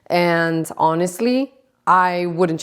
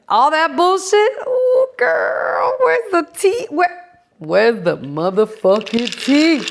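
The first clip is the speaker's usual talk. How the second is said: put-on sassy gossip girl; 9 semitones higher, slower than usual. Gossip-hungry sassy girlfriend voice.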